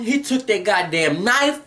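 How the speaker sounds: ordinary talk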